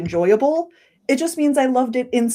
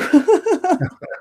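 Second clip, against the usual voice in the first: high-pitched laugh; laughter; 11 semitones higher than usual. Real, not acted. Thanking a co-host for the forgotten name, laughing. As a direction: laugh after being rescued.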